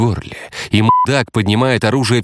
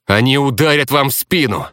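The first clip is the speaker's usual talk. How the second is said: higher, urgent shout; warning cry; 7 semitones higher than usual. Acted. Romeo warning the streamer of betrayal, crying out. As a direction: call out the danger to her. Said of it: "выкрикнул Ромео".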